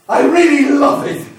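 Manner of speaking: high, loud gleeful cry; exultant outburst. Acted. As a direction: burst out with passion, nearly laughing. Fire character bursts out in glee.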